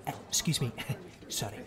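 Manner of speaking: very soft, low, polite murmur; hushed apology. Acted. Late father excuses himself among seated audience, hushed. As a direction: apologise under your breath as you squeeze past. Squeezing into theatre seat.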